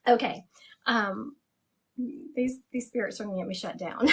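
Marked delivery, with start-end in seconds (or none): none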